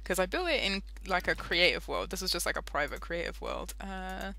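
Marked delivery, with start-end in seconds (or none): none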